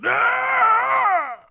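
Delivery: long, high, piercing scream; loud scream. Retold or imitated. Father imitating his screaming baby son, loud scream. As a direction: scream it out at full volume. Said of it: "he was screaming".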